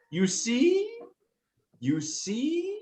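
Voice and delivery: high, slow, measured dragon counsel; high-pitched dragon voice. Acted. The second dragon counsels patience to the larger one, high and slow. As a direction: lift the pitch and draw out the phrases. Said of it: "the high-pitched voice say".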